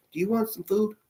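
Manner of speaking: put-on high, soft child's voice; little boy voice. A little boy offering his plate to strangers, high and soft. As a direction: sweetly offer food like a small boy.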